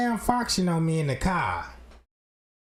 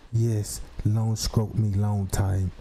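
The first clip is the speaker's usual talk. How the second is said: deep, slow robot-woman voice; robotic clone voice; 11 semitones lower, slower than usual. Acted. Clone woman purring at him in the car, deep and slow. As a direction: drag it out low like a malfunctioning android.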